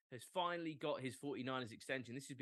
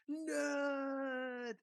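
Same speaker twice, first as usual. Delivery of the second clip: Homer shouting nerd; 13 semitones higher than usual. Retold or imitated. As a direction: bellow "Nerd!" like a jock cartoon dad. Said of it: "thinking of Homer in The Simpsons".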